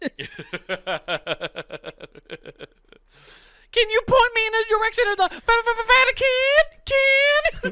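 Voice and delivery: very high screechy rock-singer impression. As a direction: stutter and stretch it like a hair-metal frontman. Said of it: "so I thought of [name]".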